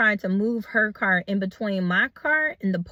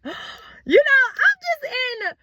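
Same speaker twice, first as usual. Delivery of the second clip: shocked gasp; 4 dB louder, slower than usual. Retold or imitated. Shocked, emotional retelling.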